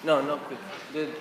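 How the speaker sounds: ordinary talk, nothing marked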